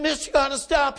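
high, pressing plea; urgent warning. Retold or imitated. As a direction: plead with the driver to halt. Stranger urgently warning the driver.

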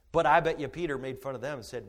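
ordinary talk

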